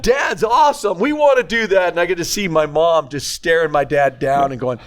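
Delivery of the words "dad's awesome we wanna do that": put-on excited boys.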